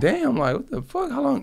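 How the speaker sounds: replaying the moment he learns the date; shocked squeal. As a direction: voice shoots up in retold shock. Retold shock, voice jumps high.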